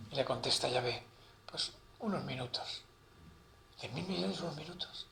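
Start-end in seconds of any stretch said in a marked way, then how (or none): 1.5-2.8 soft, offhand answer; quietly played voice of God in a joke; understated divine reply. 3.8-5.1 very soft, musing repetition; softly acted pondering in a joke; hushed echo. Quiet echo inside the acted joke.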